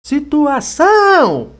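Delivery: high, loud, drawn-out "situação" swelling on ÇÃO; calling-out demo. Shown as a demonstration. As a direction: holler "situação" with a long last syllable. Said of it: "Tá aí a sílaba tônica". (grito alongado no ÇÃO)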